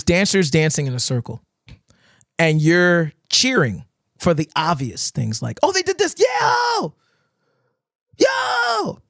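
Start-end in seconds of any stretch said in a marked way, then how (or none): 5.6-9.0 much higher imitated hype yell; mock crowd cheer. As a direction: shout like a fan at a dance circle.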